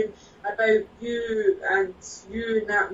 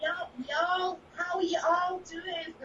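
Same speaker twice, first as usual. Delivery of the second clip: higher-pitched playful Deep South accent; attempted Deep South accent; 6 semitones higher, 5 dB quieter than usual. Shown as a demonstration. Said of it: "I think that's Deep South".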